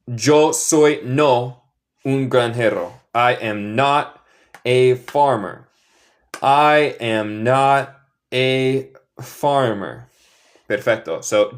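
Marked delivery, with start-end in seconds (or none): 0.1-3.0 yo soy no, very slowly; slow word by word. Shown as a demonstration. Teacher slowly spells out the English word order in Spanish. 3.1-10.1 I am not a farmer, very slow and clear; slow careful model. Shown as a demonstration. Teacher slowly models the negative for learners. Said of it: "decimos not".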